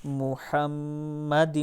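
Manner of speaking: محمد drawn out slowly with doubled m; slow Quranic-style recitation of محمد. Shown as a demonstration. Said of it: "لا کو کھینچیں گے".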